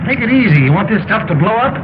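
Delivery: high-pitched, urgent caution; alarmed warning. Acted. Officer warning about explosive stuff, alarmed. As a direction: warn them off in alarm.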